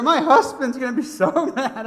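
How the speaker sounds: high, loud imitated fretting; mock dismay. Preacher imitating the driver dreading her husband, mock dismay.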